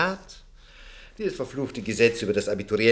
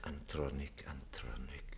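whispered to himself; 8 semitones lower, 17 dB quieter than usual. Acted. Trying to recall the name.

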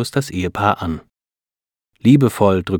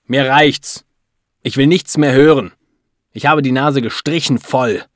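raised, exasperated outburst; fed-up anger; 5 semitones higher, 5 dB louder than usual. A narrator's reading. Husband won't let the doctor speak, fed up and angry. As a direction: refuse loudly to hear any more. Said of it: "lässt die Ärztin nicht zu Wort kommen".

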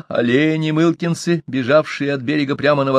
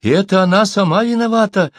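high, insistent squeal; storyteller voicing a squealing captive; 10 semitones higher, 4 dB louder than usual. A narrator's reading. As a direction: squeal the blame in a raised voice. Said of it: "заверещал он".

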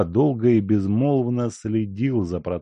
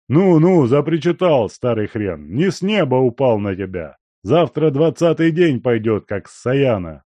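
put-on loud, higher blustering old man; gruff old miner; 5 semitones higher, 7 dB louder than usual. A narrator's reading. Guest telling the servant he came from the mine, loud and gruff. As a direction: brush off the fussing with a laugh. Danila answering.

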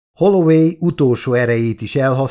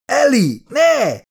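frightened cry; 13 semitones higher, slower than usual. Acted. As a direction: scream her name in fear. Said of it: "rémülten". (rémült, magas kiáltás)

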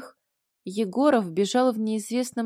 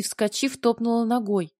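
usual speaking voice

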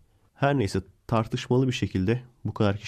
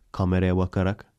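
ordinary delivery

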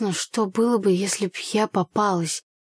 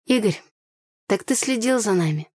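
gasping in surprise; 2 semitones higher than usual. A narrator's reading. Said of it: "ахнула я".

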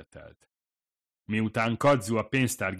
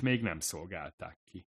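ordinary delivery, nothing marked

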